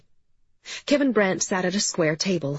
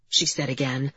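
usual speaking voice

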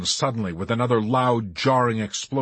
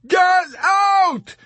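high, stretched-out screamed order; hysterical yell; 8 dB louder than usual. A narrator's reading. Yossarian clubbing Arfi to drive him away, hysterical yell. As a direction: scream each word at him. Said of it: "Yossarian yelled hysterically".

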